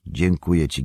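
ordinary delivery, nothing marked

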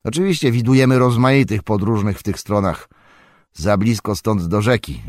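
a jovial farmer welcoming guests; hearty farmer. A narrator's reading. Farmer Maggot talks. (serdeczny głos farmera)

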